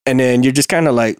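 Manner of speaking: ordinary delivery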